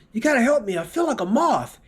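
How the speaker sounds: high, pleading patient voice. Acted. Patient begging the doctor for help, high and pleading.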